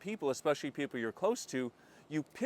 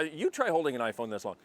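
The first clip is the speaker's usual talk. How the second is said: usual speaking voice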